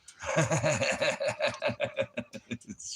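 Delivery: high laugh. Real, not acted. Laughs after singing.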